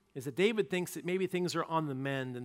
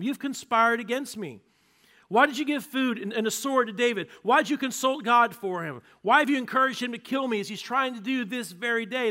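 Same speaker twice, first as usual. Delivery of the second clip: loud, high, furious king accusing; furious accusation; 9 semitones higher, 9 dB louder than usual. Acted. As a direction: hurl the charges at the priest. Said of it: "Saul begins to explain".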